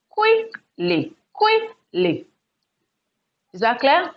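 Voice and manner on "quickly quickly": QUICKly with a very high QUICK, falling ly; stress on first syllable. Shown as a demonstration. Teacher confirming the answer, QUICK in quickly stressed high. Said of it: "The stress should be on the first syllable".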